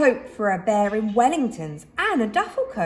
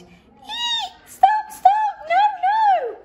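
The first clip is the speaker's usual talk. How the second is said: very high, loud panicked squeals; tiny creatures screaming in terror; mice shrieking; 4 dB louder, much slower than usual. A narrator's reading. Mice shrieking as Boris comes to find them, terrified.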